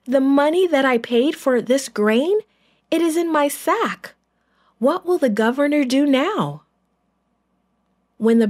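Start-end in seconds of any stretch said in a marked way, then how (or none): 0.0-6.6 worried brother. A narrator's reading. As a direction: sound rattled by the found money. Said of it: "he called to the others".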